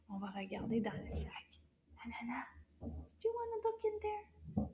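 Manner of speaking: put-on hushed, secretive question to the kids; playful whispered quiz question. Whispering while looking into the bag.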